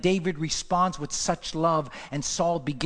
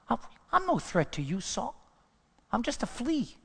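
humble David; 7 semitones higher, 3 dB quieter than usual. Acted. David's humble reply, softer.

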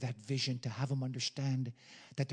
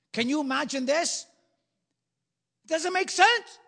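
climbing to a high, loud cry; incredulous preaching shout; preacher shout; 12 semitones higher, 12 dB louder than usual. Real, not acted. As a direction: build to a full-throated peak. Preacher builds to a climax.

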